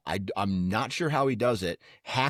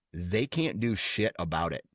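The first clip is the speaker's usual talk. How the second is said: ordinary talk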